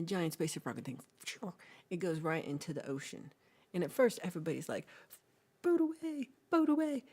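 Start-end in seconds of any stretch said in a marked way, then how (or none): 5.6-7.0 high, loud frantic cry; panicked fishermen. Retold or imitated. Fishermen fleeing the rocket, high panicked cry.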